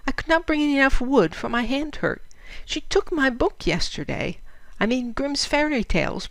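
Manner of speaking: put-on a weary little girl begging to go home; child's pleading letter. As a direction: read it as the homesick eleven-year-old writing to her mother.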